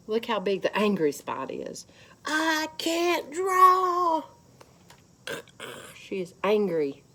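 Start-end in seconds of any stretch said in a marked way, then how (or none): 2.2-4.2 put-on high, loud, slow whiny child wail; frustrated girl. Girl who can't draw wails it to herself. Frustrated girl's voiced complaint.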